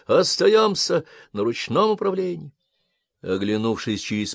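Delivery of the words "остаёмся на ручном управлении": high urgent shout; commander's shout. Acted. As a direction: bark the order over chaos. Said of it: "послышался крик командира".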